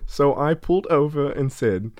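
ordinary talk

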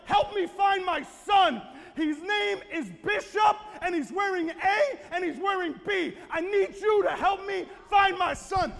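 high, loud cry for help; desperate shouted plea. Retold or imitated. Father asking shoppers to find his lost son, high urgent shouting. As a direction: shout it out to everyone within earshot.

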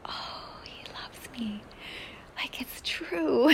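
hushed, breathless whisper of wonder; awed whisper. Retold or imitated. Telling listeners about her dream, hushed amazed whisper. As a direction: whisper it like a secret you just learned.

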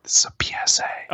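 urgent whisper aside. Real, not acted. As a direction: hiss the reminder under breath. Hushed reminder mid-bit.

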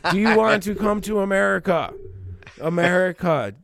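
high, loud crooning invitation; R. Kelly. Acted. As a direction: belt it out as a singer's mock serenade. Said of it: "I did the R. Kelly".

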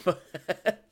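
ordinary talk, nothing marked

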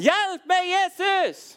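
piercingly high cry for help; high, loud desperate plea. Acted. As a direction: yell for Jesus at full voice. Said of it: "men han roper enda høyere".

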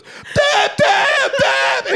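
very high, loud robotic bleats. Retold or imitated. Retelling Strennan short-circuiting under the bar, shrieked robot voice. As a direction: bleat each word like a rewired robot. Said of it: "like a robot that fucking just all of a sudden just rewired".

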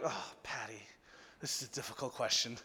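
soft, low, sighing admission; stumped reply. Retold or imitated. Pastor stumped by a girl's hard question, hushed and at a loss. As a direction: drop right down, as if at a loss.

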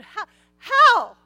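sharp high loud How?; a hammed-up incredulous retort; indignant how. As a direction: fire the question back in disbelief. Mock Zacharias pressing the angel.

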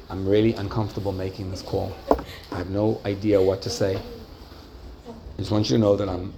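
soft, low, halting condolence call; slow, heartfelt tone. Shown as a demonstration. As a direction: feel their pain and speak gently, with pauses. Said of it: "in a very slow tone of voice".

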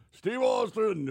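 high loud call of a name; hollering across the plane cabin. Retold or imitated. On a small plane, Gene calling out to him, loud and high. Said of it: "I hear Gene going".

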